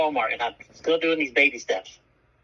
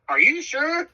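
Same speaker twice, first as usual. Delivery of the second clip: worried wife; 12 semitones higher, slower than usual. Retold or imitated. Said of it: "She goes".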